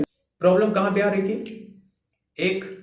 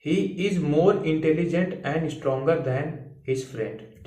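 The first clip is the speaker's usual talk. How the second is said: slow dictation; much slower than usual. Shown as a demonstration. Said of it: "देखो".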